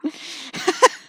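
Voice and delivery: wheezing, voiceless laughter; breathy laugh. Real, not acted. Player amused by remembered film scene, breathy laugh.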